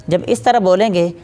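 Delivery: normal talking voice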